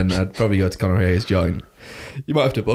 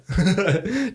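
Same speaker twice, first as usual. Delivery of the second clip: high giggle; 11 semitones higher than usual. Real, not acted. Laughs about the bar.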